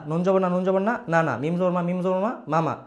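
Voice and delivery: ordinary talk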